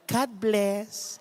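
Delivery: very soft, sweet blessing; very soft, mock-saintly God bless; pious reply. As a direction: bless him gently like a saint. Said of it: "sabi niya".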